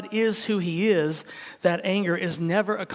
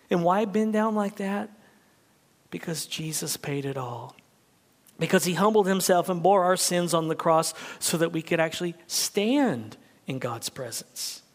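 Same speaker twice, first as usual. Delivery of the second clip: normal talking voice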